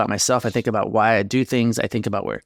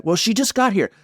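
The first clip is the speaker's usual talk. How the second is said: mock snapping back; 10 semitones higher than usual. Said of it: "she's snapping back".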